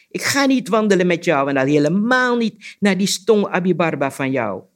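loud, high, emphatic frog voice; croaking frog. A narrator's reading. Frog refuses to walk with Anansi, loud indignant croaking. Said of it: "kwaakte de kikker".

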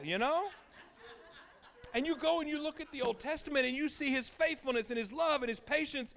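high, loud, laughing preacher drive; full-force preaching. Acted. Preacher presses the congregation at the peak, loud and driving.

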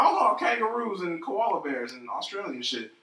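high, slow fake Australian; mock Australian. Friend making fun of Australians, mock Australian accent. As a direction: put on a stretched-out, silly Australian accent. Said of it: "some shitty Australian accent".

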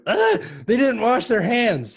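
high, slow, appalled cry; mock horror. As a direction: gasp it high as if hygiene were a scandal.

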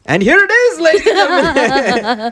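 high, laughing show announcement; mock announcer. Co-host announces death's arrival to the panel, mock-grand announcer. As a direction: herald it like a hammed-up emcee.

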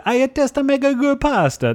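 high-pitched hammed-up Italian accent; Elon Musk Italian accent. Co-host repeats his pasta joke, put-on Italian accent. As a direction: parody a proud pasta cook with a bad, high Italian accent. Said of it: "I was doing my best Elon Musk Italian accent".

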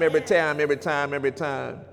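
quiet trailing repeat; fading refrain. Acted. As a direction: let the echo fade.

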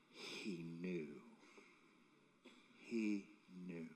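low and soft, with long pauses; grave, near-murmured repetition; hushed emphasis. Real, not acted. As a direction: let it sink in quietly.

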